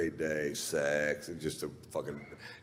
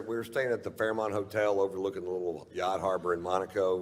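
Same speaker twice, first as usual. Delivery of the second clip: ordinary talk, nothing marked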